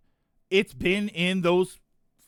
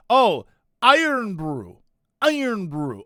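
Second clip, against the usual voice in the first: slow, louder "Iron Brew" with a careful Scottish accent; Scottish accent attempt; 5 dB louder, slower than usual. Shown as a demonstration. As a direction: give "Iron Brew" your best respectful Scottish accent. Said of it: "with as much of a Scottish accent as I can".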